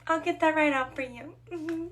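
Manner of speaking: high, sugary customer-service voice; put-on polite server chirp; forced composure. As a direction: keep it pleasant through clenched patience. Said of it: "I keep my composure".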